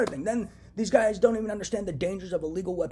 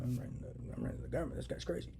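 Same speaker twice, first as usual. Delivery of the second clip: whisper; 4 semitones lower, 9 dB quieter than usual. Retold or imitated. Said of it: "I was like".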